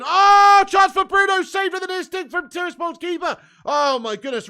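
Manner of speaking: huge high yell, easing slightly as the save comes; excited commentary shout. Real, not acted. Commentator calling Bruno's shot live, shouting excitedly. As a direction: burst out yelling at the chance.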